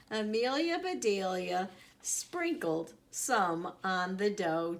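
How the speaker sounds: ordinary delivery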